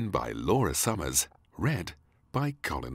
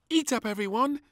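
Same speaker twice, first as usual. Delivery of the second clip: high, bossy little hostess; princess hosting tea party; 5 dB louder than usual. Acted. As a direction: play hostess to the dolls. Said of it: "she said, pointing".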